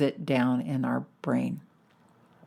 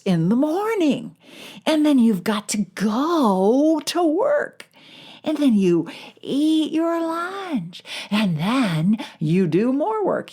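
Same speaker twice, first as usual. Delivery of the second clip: much higher, slow and bouncy with eager energy; animated, excited; 8 semitones higher, 3 dB louder, slower than usual. Retold or imitated. Anchorman Ted cheerfully answers Mary's boredom, excitedly animated. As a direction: sell the dull routine like it's thrilling news. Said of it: "very animated... very excitedly".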